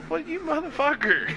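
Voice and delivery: high, outraged jab at the friend; mock indignant. Retold indignation at friend in game.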